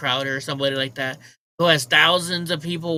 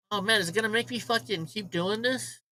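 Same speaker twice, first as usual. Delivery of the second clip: ordinary delivery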